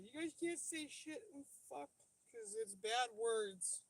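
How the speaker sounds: high, slow mock-pious scolding; prissy Protestant impression. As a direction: play a prudish churchgoer, high-pitched and drawn out. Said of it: "That was just an impression of Protestants in general".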